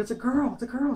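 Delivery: high, thrilled repeat; flipping out. Retold or imitated. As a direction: blurt it out overjoyed, voice jumping high. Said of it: "I start flipping out".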